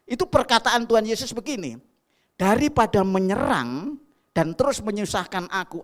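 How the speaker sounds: loud, high, driving sermon voice; full-force preaching. Acted. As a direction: preach it out over the whole congregation.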